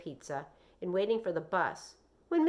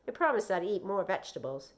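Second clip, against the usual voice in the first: ordinary delivery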